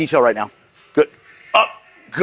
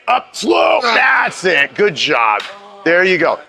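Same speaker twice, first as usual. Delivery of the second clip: coach shout; 4 semitones higher, much slower than usual. Real, not acted. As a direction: yell it out to push the lifter. Coach calling out during the set.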